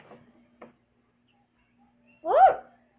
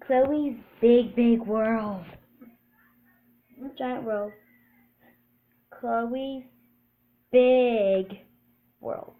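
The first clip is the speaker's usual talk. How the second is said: announcer voice; 4 dB louder, much slower than usual. Acted. Announcing her show title.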